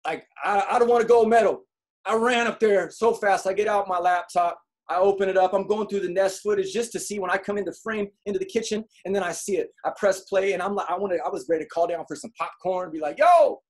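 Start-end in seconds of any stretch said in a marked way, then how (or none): 0.4-3.5 excited retelling. Retold or imitated. Retelling excited dash upstairs. 13.2-13.6 loud, high-pitched "yo" shout; gleeful call-out. Retold or imitated. Calling downstairs for popcorn, gleeful.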